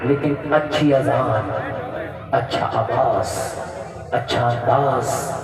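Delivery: very low, slow and deliberate; deep, measured list with pauses between items; hushed-down listing. Real, not acted. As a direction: drop the pitch right down and weigh each item. Listing after shouted demand, voice dropped.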